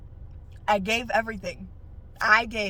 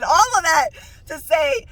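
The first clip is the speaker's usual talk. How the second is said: louder and higher, preaching the point; emphatic shout; 6 semitones higher, 10 dB louder, slower than usual. Real, not acted. Driving home her point to viewers, loud preaching. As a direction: hammer home the lesson.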